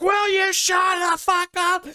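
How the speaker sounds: very high mock shout; imitated freak-out scream. Detective freaking out at the hospital, high mock scream. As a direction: impersonate a man suddenly losing it. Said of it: "he just freaks the fuck out".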